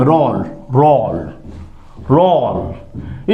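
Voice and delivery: "रोल" stretched very slowly and low, repeated; slow model word. Shown as a demonstration. Teacher drilling the new word for students, slow and low. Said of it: "ये हमारा रोल हो गया".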